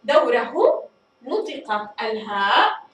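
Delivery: دوره said very slowly with a clear final هو; drawn-out model, each sound in دوره spelled out. Shown as a demonstration. Teacher to students, showing how the ha is pronounced: slow demo. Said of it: "فالهاء هنا أصبحت واضحة".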